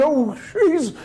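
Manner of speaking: high-pitched mock disbelief; incredulous. Husband watching his wife smash the esrog, high and incredulous. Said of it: "He's incredulous".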